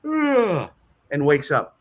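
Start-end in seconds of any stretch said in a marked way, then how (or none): 0.1-0.7 a sudden high groan of someone jolted awake; startled waking groan. Retold or imitated. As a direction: groan like a drunk snapped out of sleep by a chime. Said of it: "and the guy goes".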